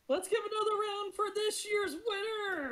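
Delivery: high, rousing crowd call; rally the crowd to applaud; announcer's call. Acted. Host announcing the contest winner to the crowd, rousing call.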